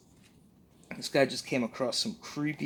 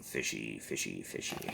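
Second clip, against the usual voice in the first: creepy whisper; 11 semitones lower, 8 dB quieter than usual. Acted. Creepy repeat about fish people.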